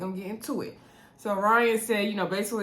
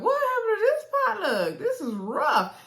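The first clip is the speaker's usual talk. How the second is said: mock crying wail; 13 semitones higher than usual. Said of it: "I was like".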